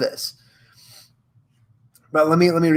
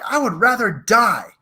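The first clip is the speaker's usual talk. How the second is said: higher, dramatic refusal; hammed-up defiance from under the rover; trapped commander; 7 semitones higher than usual. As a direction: refuse melodramatically. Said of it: "And the commander's like".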